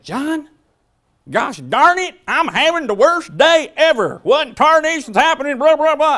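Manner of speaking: high, loud, flustered folksy ranting; mock-exasperated high voice, all gosh darn and tarnation; imitating Lisa Harrison. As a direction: play a frazzled colleague venting at full tilt. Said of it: "She'll be like".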